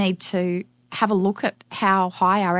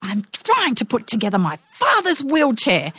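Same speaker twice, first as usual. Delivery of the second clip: mock-exasperated woman, pitched up; scowling retort; 5 semitones higher than usual. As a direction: imitate a woman snapping back at a child. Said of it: "she scowled at him and she said".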